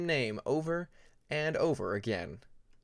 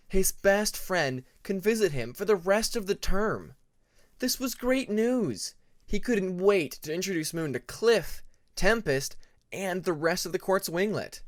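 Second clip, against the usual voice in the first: narrated joy; 5 semitones higher, 4 dB louder than usual. A narrator's reading.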